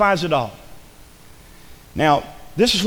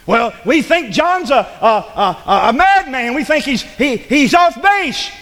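high, driving pulpit delivery; full-force preaching; 7 semitones higher, 4 dB louder than usual. Acted. Preacher urging people away from bad company, full-force preaching. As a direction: preach it hard, pitched well up.

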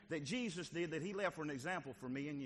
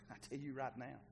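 ordinary delivery

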